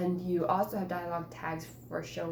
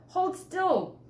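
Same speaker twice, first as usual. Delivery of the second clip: urgent brother; 12 semitones higher, 7 dB louder than usual. Acted. Brother removing the mouse.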